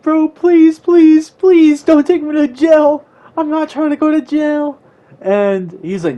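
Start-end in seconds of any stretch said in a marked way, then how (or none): 0.0-4.8 high, loud, desperate begging; mock crying plea. Second grader begging the cop not to jail him, mock crying plea. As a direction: beg in a hammed-up wail, pitched way up. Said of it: "And I just started crying".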